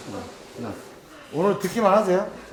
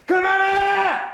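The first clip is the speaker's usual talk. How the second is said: sharp high yell; shout; 13 semitones higher, 5 dB louder, much slower than usual. Shown as a demonstration. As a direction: shout it out to stop. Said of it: "큰 소리 한번 할까".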